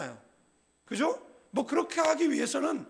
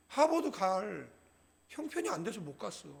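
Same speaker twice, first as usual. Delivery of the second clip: polite excuse; 4 semitones lower, 7 dB quieter than usual. Shown as a demonstration. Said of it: "솔직한 거는".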